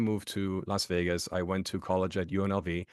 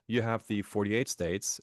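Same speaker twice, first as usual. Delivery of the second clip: ordinary talk, nothing marked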